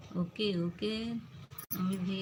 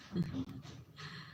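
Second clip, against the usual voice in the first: soft breathy laugh; 8 dB quieter than usual. Real, not acted. Laugh after teasing.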